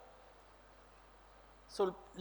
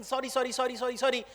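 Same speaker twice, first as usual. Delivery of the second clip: softer string of apologies; bowing apology; 5 dB quieter, much faster than usual. Acted. Confused lady bowing to upset princes, apologetic. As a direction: apologize over and over, meekly. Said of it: "starts bowing and saying".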